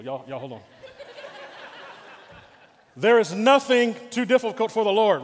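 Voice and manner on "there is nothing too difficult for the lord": louder, stronger declaration; loud preaching. Acted. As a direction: proclaim it with force. Repeats louder to rouse crowd.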